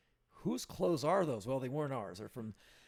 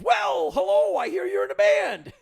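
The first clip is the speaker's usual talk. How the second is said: put-on loud old man; 15 dB louder than usual. Mister Ginn voiced in story.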